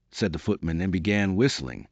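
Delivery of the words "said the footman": usual speaking voice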